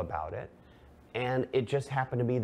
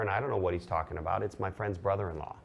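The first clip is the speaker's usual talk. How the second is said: ordinary talk, nothing marked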